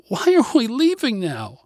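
put-on high-pitched little-girl protest; upset child's cry. A narrator's reading. Girl protesting to dad about leaving, high cry. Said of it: "she cried to Dad".

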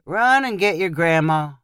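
plain reading voice

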